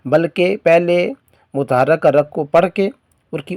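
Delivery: ordinary talk, nothing marked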